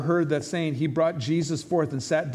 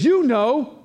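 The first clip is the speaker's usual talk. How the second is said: higher, louder accusing snap; imitating angry wife; 7 semitones higher, 6 dB louder than usual. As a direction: throw it back at him, higher. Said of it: "And she says".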